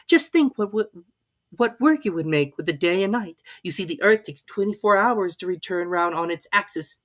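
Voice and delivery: put-on high, pleading girl's voice; a polite girl asking; young Alice. A narrator's reading. Alice showing off.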